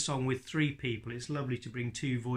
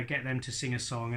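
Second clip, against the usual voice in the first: usual speaking voice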